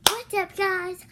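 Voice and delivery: bright loud hello to viewers; big vlog hello; upbeat greeting. Real, not acted. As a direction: call out the greeting with energy.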